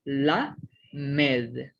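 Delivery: lamed read slowly; "la med" drawn out syllable by syllable, la then med; slow spelled-out reading. Shown as a demonstration. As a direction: say "la med" slowly, as the letter's name. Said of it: "Entonces dice".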